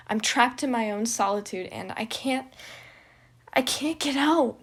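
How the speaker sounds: loud, high sobbing confession; tearful outburst. Acted. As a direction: pour it out through tears.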